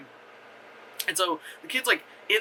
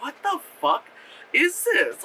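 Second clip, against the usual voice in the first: high-pitched, baffled disbelief; mock bewilderment; 12 semitones higher than usual. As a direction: act out a stunned viewer, pitched way up. Acted baffled viewer reaction.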